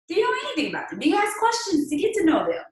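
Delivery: pointed high-pitched challenge; emphatic high question. Acted. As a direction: push it up and press them.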